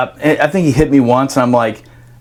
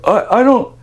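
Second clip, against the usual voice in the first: stammering confusion; 8 semitones higher than usual. Retold or imitated. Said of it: "I was like".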